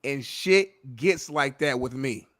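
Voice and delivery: slow, deliberate quoting of the line; slow deadpan quote. Retold or imitated. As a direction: savor every word of the quote. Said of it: "and I quote".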